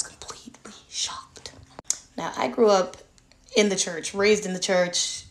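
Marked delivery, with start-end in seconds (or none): 0.0-1.5 unvoiced whisper; hushed shock. Real, not acted. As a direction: breathe it out in disbelief. Whispered emphasis.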